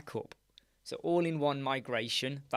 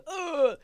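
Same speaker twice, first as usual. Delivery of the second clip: high, loud mock groan of disgust; hammed-up ugh of disgust; 6 dB louder than usual. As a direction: imitate a viewer's horrified ugh. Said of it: "don't jump up and down and go".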